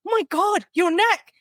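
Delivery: very high-pitched, hammed-up shock; mock alarm. Triage nurse sees hammer in man's neck, mock horrified. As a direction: imitate a horrified onlooker gasping the words.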